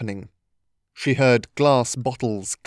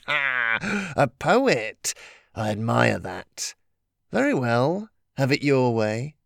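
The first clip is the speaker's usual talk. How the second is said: mocking, sly trickster voice; mock him like a trickster spirit; sly teasing trickster spirit; slower than usual.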